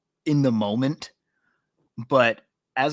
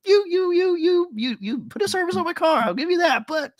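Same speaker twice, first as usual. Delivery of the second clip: high, loud whiny mock complaint; imitating a fussy customer, pitched way up; mocking Derek; 4 dB louder than usual. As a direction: squeal it like a fussy prima donna. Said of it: "but I could see you being like".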